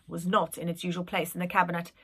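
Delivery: ordinary delivery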